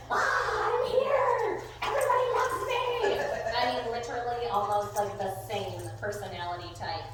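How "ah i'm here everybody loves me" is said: high, loud, over-excited dog voice; high-pitched dog bursting in with excitement; excitable Frenchie. Acted. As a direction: squeal it like an attention-loving dog. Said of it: "every Frenchie I've ever met".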